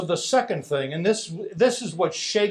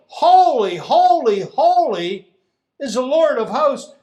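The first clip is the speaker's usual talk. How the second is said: loud and higher, a huge proclamation; booming yell; 5 semitones higher, 5 dB louder than usual. Acted. Seraph calling out to the other, booming yell. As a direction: shout it so the door hinges shake. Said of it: "this massive voice ... starts yelling".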